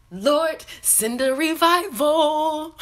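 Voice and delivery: high, loud, slow preacher's cry; mock prayer. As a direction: cry out to the Lord like a hammed-up preacher.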